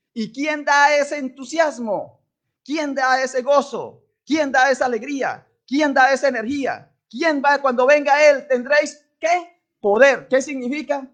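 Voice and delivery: loud, rising preacher's cry; preaching at full force. Acted. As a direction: lift the congregation with each question. (predicación a todo pulmón)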